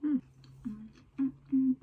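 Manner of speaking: chewing while humming; humming through closed full mouth. Real, not acted. Seen 3: Bit burger at -5; cheeks puffed and lips closed chewing throughout.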